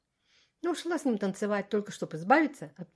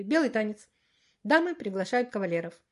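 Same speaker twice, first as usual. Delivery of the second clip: ordinary delivery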